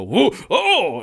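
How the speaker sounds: a giant jolted by a rat underfoot; startled giant. Acted. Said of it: "you see the feet go".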